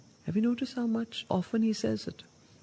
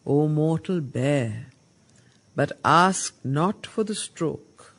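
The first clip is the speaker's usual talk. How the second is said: slow, louder call to the mortal; solemn declamation; 3 semitones lower, 5 dB louder, slower than usual. Acted. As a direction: proclaim it as a commandment. Narad's call read again.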